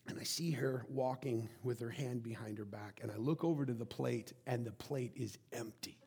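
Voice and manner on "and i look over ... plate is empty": ordinary talk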